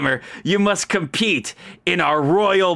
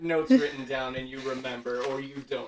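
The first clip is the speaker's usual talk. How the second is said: quiet aside; 2 semitones lower, 8 dB quieter than usual. Real, not acted. GM aside, very soft.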